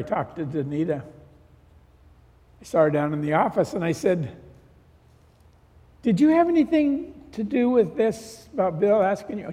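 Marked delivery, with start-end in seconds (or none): 6.0-9.5 raised-pitch suspicious questioning, acted out; mock confrontation. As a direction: playfully demand an answer, higher voice. Retold confronting of a suspected schemer.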